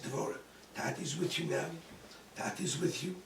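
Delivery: soft, reassuring father; calm a frightened child gently; soothing. Retold or imitated. Calming his burned daughter.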